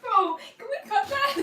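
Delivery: high, loud laughing plea; cracking up, begging. Real, not acted. Laughing at own bad joke.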